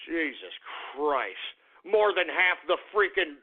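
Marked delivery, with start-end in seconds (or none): 0.1-1.4 low, deflated curse; weary exasperation. Real, not acted. Host sick of his opponents, low weary exasperation. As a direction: drop low, fed up after the outburst. Exasperated aside after outburst.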